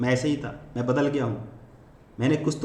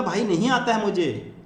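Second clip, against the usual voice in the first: put-on child to teacher; 7 semitones higher, 3 dB louder than usual. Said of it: "teacher से बोलना".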